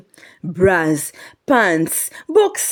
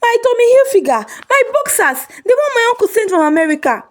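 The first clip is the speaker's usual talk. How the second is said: frantic wailing; faster than usual. Acted. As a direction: cry out like a robbed man. Ikechi discovers stolen boxers.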